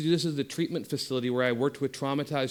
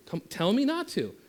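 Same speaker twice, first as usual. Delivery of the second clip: teasing challenge; 8 semitones higher than usual. Shown as a demonstration. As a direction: pitch jumps higher on the challenge. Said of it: "I'm like".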